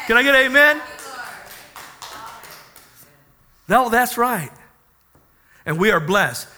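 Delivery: emphatic, driving sermon delivery; forceful preaching. Real, not acted. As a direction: proclaim scripture to stir the congregation.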